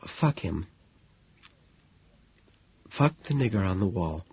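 barely audible, hushed reading; below a whisper. Acted. Woman reading the poem's ugly ending, below a whisper. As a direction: read it out as quietly as possible. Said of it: "in a voice below a whisper".

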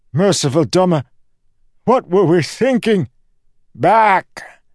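high, loud, horrified cry; panicked Ancient. Acted. Ancient realizes the danger, panicked shouting.

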